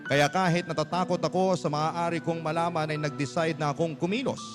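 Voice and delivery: ordinary talk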